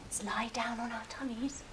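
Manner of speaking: hushed whisper, sneaking away. Pretend. Teacher whispers to children sneaking past sleeping sharks. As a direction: breathe the words out so the sharks stay asleep. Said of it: "swim away quietly so we don't wake the sharks".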